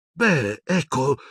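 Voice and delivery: high, hesitant little start; increasingly nervous. A narrator's reading. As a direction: hedge nervously before explaining. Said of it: "riprese Hermione sempre più nervosa". (esitazione nervosa, acuta)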